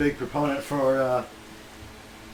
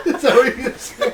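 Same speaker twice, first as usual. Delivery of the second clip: laughing; 8 dB louder, slower than usual. Real, not acted. Laughing over Zodiac joke.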